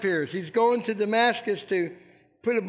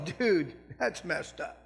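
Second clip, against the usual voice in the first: heathens' comment; 4 semitones lower, 6 dB quieter than usual. Retold or imitated. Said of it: "the heathens look and go".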